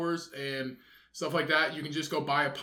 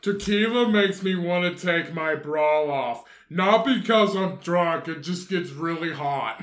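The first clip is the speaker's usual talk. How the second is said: put-on drunk woman voice; 3 dB louder than usual. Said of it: "Sounds like, uh, what's her name off of".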